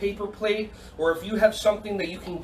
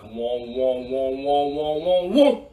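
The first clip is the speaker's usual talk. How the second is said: laser sound effect; 7 semitones lower, much slower than usual. Pretend. Coach playing laser game with kids, rising laser sound. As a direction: build the "wong" noises upward.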